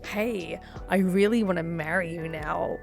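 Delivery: normal talking voice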